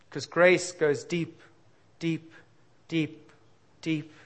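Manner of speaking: deep deep deep, dropping away; repeated deep, fading. Acted. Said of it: "to the bottom".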